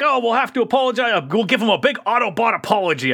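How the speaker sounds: high, fast, chirpy voice; breezy overly upbeat apology; lightly voiced cheery robot; mock cheerful.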